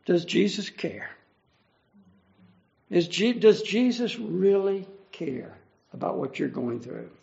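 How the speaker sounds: normal talking voice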